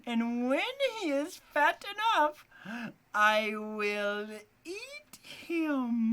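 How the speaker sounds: high, loud, slow witch voice; witch threat. A narrator's reading. Witch telling Gretel she will eat Hansel, high loud voice. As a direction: gloat over the feast like a witch.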